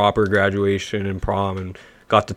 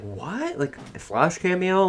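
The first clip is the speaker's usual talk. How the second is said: confused surprise; 8 semitones higher, 8 dB quieter than usual. Retold or imitated. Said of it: "I was like".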